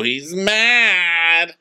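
high, drawn-out mock dread; mock teasing alarm. Onlookers react to his serious talk, mock alarm.

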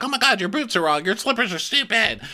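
high-pitched mock scorn; put-on high voice, dismissive; mocking impression of a housewife; imitating Kyle. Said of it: "And Kyle, like".

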